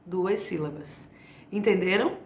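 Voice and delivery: ordinary talk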